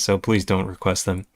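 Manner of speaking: ordinary delivery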